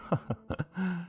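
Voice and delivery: short, high, soft laugh; chuckle. Acted. Farmer to his guest about the meat, chuckling. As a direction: let out a small chuckle.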